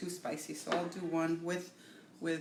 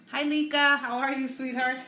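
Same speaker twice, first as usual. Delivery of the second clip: excited greeting; 6 semitones higher, 6 dB louder than usual. Real, not acted. Greets a viewer on live stream.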